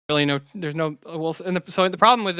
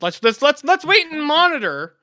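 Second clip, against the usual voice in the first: drawn-out, high, louder mock suggestion; 10 semitones higher, 4 dB louder, slower than usual. Panelist mocking a wait-and-see plan instead of stopping him, mocking. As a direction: put on a raised, slow voice to mock the idea.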